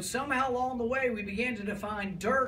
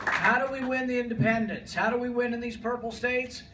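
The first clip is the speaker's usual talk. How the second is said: rally speech; 2 semitones higher, 3 dB louder than usual. Real, not acted. Rally crowd, rhetorical questions.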